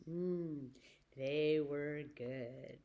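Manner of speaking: usual speaking voice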